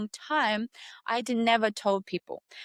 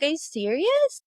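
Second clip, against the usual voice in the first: incredulous; 7 semitones higher than usual. Retold or imitated. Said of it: "I was like".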